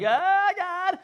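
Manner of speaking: high, loud mock wail; hammed-up yelp of distress; acted anguished cry. Boy gripping the bus rail after his accident, acted anguished cry. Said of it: "he was like".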